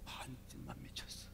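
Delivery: hushed whispered punchline; whispering. Real, not acted. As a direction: drop to a whisper. Confiding punchline. (속삭이듯)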